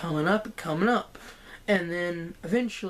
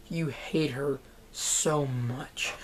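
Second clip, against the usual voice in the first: softer, slow, hushed whisper; 5 semitones lower, 5 dB quieter, much slower than usual. Real, not acted. Vlogger telling viewers how hateful the character is, slow whisper. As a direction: lean in and whisper it slowly. Repeats line, then whispers it.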